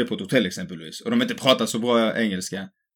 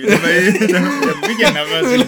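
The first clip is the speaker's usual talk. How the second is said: high squeaky laugh through the words; giggling, can barely get it out; laughing hard at herself; 7 semitones higher, 6 dB louder, slower than usual. Real, not acted. Laughing at shared memory.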